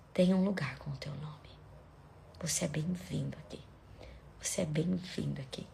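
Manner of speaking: very soft, low, near-whispered welcome; hushed prayer. Real, not acted. Preacher assures listeners they are welcome, hushed prayer. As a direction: breathe it gently, leaving space between phrases.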